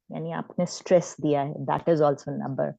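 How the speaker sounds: usual speaking voice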